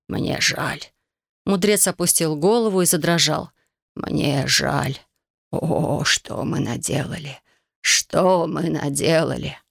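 remorseful whisper. Acted. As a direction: breathe out guilt, slowly. Sage trembles in remorse.

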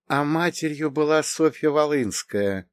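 pensive, lightly voiced woman; thoughtful. A narrator's reading. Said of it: "задумчиво промолвила она".

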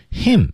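"Him" alone, high-pitched with its H heard; sounded H. Shown as a demonstration. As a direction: isolate "Him" and voice the H. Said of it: "这种H开头的单词". (单独读“Him”，H发出来)

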